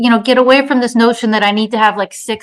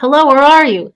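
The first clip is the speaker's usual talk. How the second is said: loud, raised searching call; 5 semitones higher, 5 dB louder than usual. Acted. Woman searching the maze, calling out. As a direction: call out for someone lost. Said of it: "she called".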